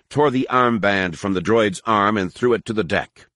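ordinary delivery, nothing marked

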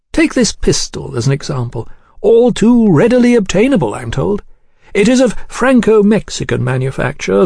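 high, urbane lecturing voice; suave menacing gentleman. Acted. Gentleman showing a pistol to the diner, suavely menacing. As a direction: discourse politely on the weapon as a veiled threat.

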